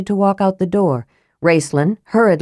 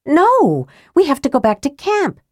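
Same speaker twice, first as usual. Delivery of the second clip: put-on higher, firm young woman; insisting; 9 semitones higher than usual. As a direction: dig in your heels. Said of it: "Tika insisted".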